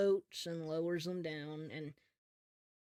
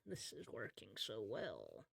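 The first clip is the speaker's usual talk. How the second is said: low whispered pirate chuckle and gloat; pirate captain monologue; 10 semitones lower, 17 dB quieter than usual. Acted. Captain monologuing to himself, whispered pirate gloat. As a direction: gloat to himself under his breath like a scheming pirate. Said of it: "He's like".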